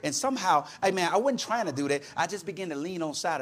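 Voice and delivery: normal talking voice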